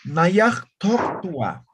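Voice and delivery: "Nayaj tok tua" spelled out slowly, each part separate; slow syllable by syllable. Shown as a demonstration. Teacher building the verb for students, slow and segmented. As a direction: drag out "Nayaj tok tua" piece by piece for the class. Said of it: "ponemos la terminación wa".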